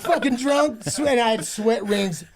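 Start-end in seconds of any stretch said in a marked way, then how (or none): 0.0-1.1 high, laughing put-down; laughing mock jeer. Retelling how passengers saw him, laughing jeer. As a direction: sneer it through a laugh. Mocking himself as the crowd.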